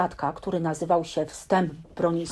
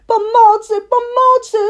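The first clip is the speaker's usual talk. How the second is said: much higher, louder frantic screams for help; screaming; 11 dB louder than usual. Acted. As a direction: shriek for help in a panic. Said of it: "krzyczał prosiaczek".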